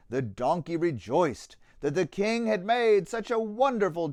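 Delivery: put-on lively rhyming read-aloud for children; story narration. A narrator's reading. Narrator reading a children's Easter tale, lively storytelling. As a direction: tell the tale with bounce and warmth in the rhythm.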